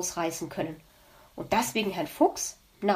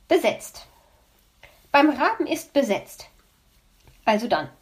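annoyed; 4 semitones higher, 4 dB louder than usual. Acted. Magpie annoyed at busy line.

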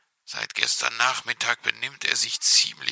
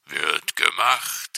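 cheerful fox; 6 semitones lower, 3 dB louder, slower than usual. A narrator's reading. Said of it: "erwiderte er fröhlich".

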